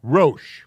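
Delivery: Rosh said with long o, clear; Hebrew word Rosh. Shown as a demonstration. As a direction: pronounce Rosh slowly and distinctly. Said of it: "the word is".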